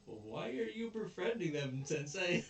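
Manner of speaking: high-pitched, incredulous question; high, hammed-up complaint; mock dismay. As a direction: imitate kids baffled by their teacher. Acted kids questioning teacher, amused.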